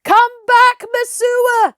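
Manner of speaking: high, loud calling yell; crowd shouting. Acted. Crowd shouting at Messua to return. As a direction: call her back angrily. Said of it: "shouted the crowd".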